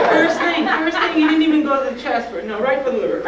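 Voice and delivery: very high, loud squealed complaint; hammed-up shriek of indignation; comic outraged wail. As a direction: wail it in pained disbelief. Reliving pain as liver is pushed.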